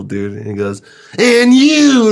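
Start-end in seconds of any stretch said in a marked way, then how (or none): 1.2-2.1 sudden high, very loud accusing yell; Mister Dane's furious outburst. Retold or imitated. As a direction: imitate a teacher exploding at one student. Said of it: "looks at me like he's the devil".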